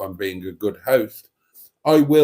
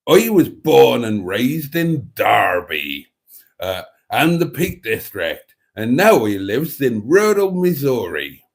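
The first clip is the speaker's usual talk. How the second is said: put-on Derby accent; 5 dB louder than usual. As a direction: ham up a Derby accent as a listener's voice. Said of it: "that is my best Derby accent".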